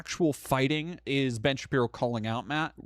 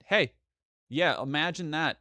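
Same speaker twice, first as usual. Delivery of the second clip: normal talking voice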